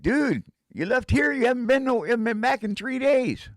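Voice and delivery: exasperated friend telling him off; Mark scolding. Retold or imitated. Mark on the phone scolding the missing friend, high and loud.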